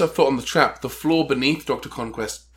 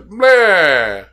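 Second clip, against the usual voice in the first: loud, higher villain "bleh"; mock villain noise; 4 semitones higher, 8 dB louder than usual. Imitating Doctor Conquest for the co-host, mock villain noise. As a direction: blurt a comic monster noise. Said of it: "or whatever it is he says".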